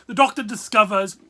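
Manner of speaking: usual speaking voice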